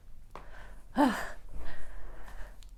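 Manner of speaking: long shaky sigh while crying; a real tearful breath out. Real, not acted. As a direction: breathe out slowly, fighting tears. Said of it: "Oh my gosh, sorry".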